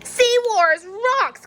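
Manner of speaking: high, slow, excited cry; cried out. Acted. Shark kid crying out while playing. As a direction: shout it with glee.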